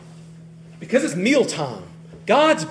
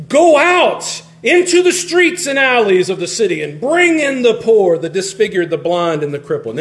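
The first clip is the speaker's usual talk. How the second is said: rage voice; 2 semitones higher, 3 dB louder than usual. Acted. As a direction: thunder the order in an acted rage, then let it subside. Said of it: "that was my best rage voice".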